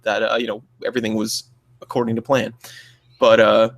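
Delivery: ordinary talk, nothing marked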